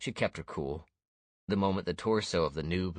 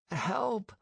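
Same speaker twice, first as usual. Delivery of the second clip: weak echoing cry. A narrator's reading. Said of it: "a weak voice echoed".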